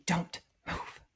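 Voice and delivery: hushed retold command; whispered warning. Hunter warning partner as buck stares, whispering. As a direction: whisper it so the deer won't hear. Secret warning with deer staring.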